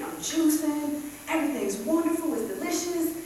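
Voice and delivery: normal talking voice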